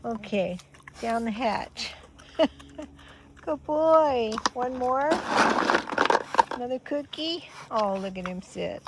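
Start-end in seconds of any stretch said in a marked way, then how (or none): 2.4-2.8 quick bright laugh; short laugh. Real, not acted. Laugh while feeding. 3.4-7.5 high pet talk praise; talking to a dog. Real, not acted.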